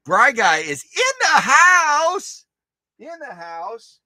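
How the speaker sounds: high, slow, loud mock MC call; hype announcer. Streamer hypes a viewer's arrival like an MC. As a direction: announce him like a ring MC.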